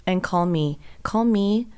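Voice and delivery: usual speaking voice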